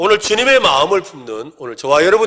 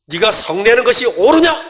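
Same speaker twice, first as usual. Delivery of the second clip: higher and louder, a stern shouted 옳으냐 with a rising end; angry rebuke; 8 semitones higher, 6 dB louder than usual. Shown as a demonstration. God shouting an angry rebuke at the angry Jonah. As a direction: thunder it at Jonah as a furious God. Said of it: "그냥 읽으면 화를 내시는 것처럼 들리죠".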